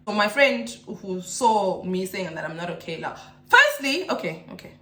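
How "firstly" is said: slow, over-excited exclamation. Real, not acted. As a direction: burst out, high and loud. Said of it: "I'm too excited now".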